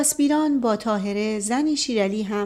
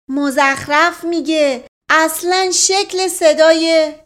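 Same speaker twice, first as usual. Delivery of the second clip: slow, loud, indignant retort; sharply; 7 semitones higher, 9 dB louder, slower than usual. Acted. Puri snapping back at his cousin, loud and sharp. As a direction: cut him down hard, word by word. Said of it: "پوری با تندی گفت".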